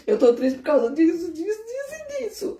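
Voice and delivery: high, whiny crying plea; imitating a crying child begging her dad; mock childish crying. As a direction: whimper it like a little child to her father. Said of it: "Igual a gente faz com o pai da gente, quando a gente é criança, eu falo assim".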